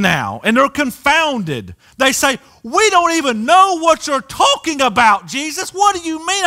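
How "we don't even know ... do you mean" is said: confused crowd. Retold or imitated. Confused crowd questions Jesus.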